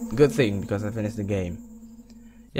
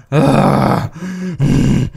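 usual speaking voice

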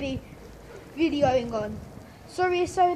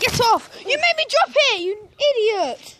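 angry; 5 semitones higher than usual. Real, not acted. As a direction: tell him off sharply. Boy snaps at brother.

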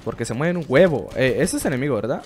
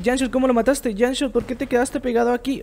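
higher, teasing imitation of a viewer asking; mock viewer voice; 10 semitones higher than usual. Imitating viewers asking the streamer how he did it, higher. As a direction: play a nosy fan quizzing the streamer. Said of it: "Van a decir".

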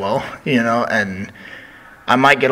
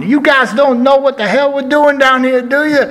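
much higher, louder mock yell in a put-on voice; Dusty yelling at camera; 6 dB louder than usual. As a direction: rant at the camera in a hammed-up voice. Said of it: "starts yelling".

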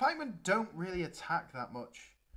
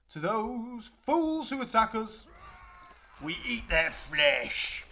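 loud, slow, savage rallying voice; growing menace, drawn out for the troops; hammed-up flesh-eater's war cry; menacing war leader; 4 semitones higher, 4 dB louder, slower than usual. Playing flesh-eating army leader.

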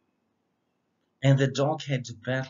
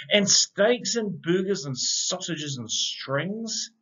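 plain reading voice

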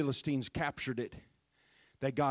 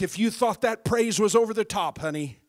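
loud, fervent preacher's cry; David shouting his praise; shouted taunt; 6 semitones higher, 13 dB louder than usual. Retold or imitated. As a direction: throw it at the doubters, full volume. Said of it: "he goes on to say something like".

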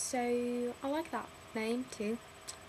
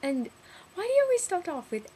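ordinary delivery

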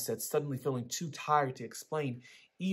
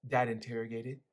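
plain reading voice

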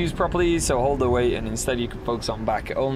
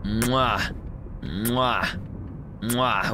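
big wet smooch; 3 semitones lower, 4 dB quieter, much slower than usual. Acted. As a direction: blow smacking kisses. Kissing the character.

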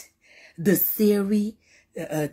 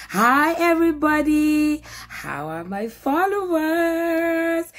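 drawn-out greeting; 8 semitones higher than usual. Real, not acted. Slow high greeting.

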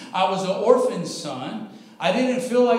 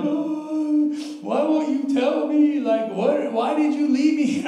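higher, sing-song mock lament; mock wailing; 6 semitones higher, slower than usual. Preacher retells crying at the mirror, mock wailing lament. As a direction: ham up a weepy complaint to God. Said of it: "I'm crying".

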